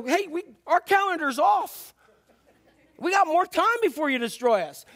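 high-pitched flustered objection; freaking out. Acted. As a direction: protest in hammed-up panic. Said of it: "They were freaking out".

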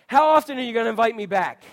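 ordinary talk

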